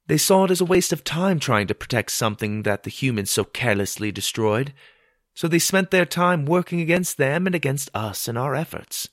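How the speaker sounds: plain reading voice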